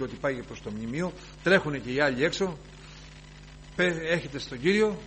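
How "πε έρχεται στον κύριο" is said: usual speaking voice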